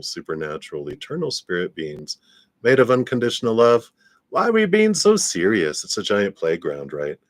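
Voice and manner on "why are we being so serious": playful. Real, not acted. Playful rhetorical question.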